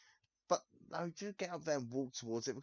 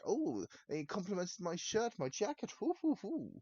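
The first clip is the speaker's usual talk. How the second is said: mocking a gullible fool; 8 semitones higher than usual. Mocking someone charmed by a compliment, high silly voice. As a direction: ham up the dopey delight, rising to a hoot.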